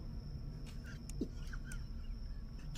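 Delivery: breathless squeaky laugh; silent high wheeze. Real, not acted. Streamer laughing at a suspect's search, wheezing.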